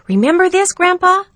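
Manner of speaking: put-on high, loud eager boy; boy pleading to be remembered; excited young boy. A narrator's reading. Jeremy voiced in story.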